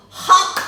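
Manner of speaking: a sharp, loud chomping "hak"; a big fish gulping Yunus down, loud and sudden. Acted. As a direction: snap it like a big fish biting.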